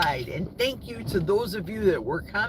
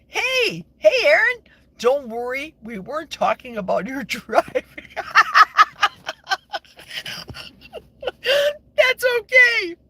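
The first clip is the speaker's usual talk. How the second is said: laughing; 11 semitones higher, 7 dB louder than usual. Real, not acted. Teasing viewer Aaron, laughing.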